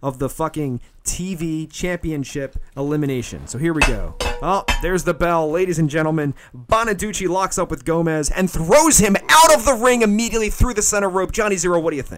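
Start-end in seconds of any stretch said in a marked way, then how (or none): 1.7-12.2 lightly voiced ringside announcer; mock play-by-play commentary; wrestling commentator. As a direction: call the match like a TV wrestling host.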